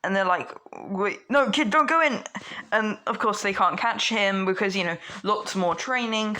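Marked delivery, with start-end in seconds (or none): 0.9-2.2 high, loud call to stop; alarmed warning. Acted. Heroes shouting to stop Deku running in, alarmed. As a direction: call out urgently after him.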